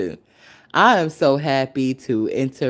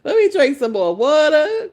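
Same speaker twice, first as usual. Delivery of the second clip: very high, louder laughing squeal; giddy laughing joy; 5 dB louder than usual. Real, not acted. Thrilled to stay in today, very high laughing squeal. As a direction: squeal with delight at staying home. Overjoyed staying home.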